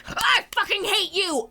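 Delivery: high furious shout; angry yell. Retold or imitated. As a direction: scream the hate out loud. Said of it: "And I'm like".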